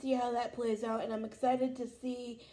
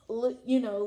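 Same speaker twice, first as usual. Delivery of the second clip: ordinary talk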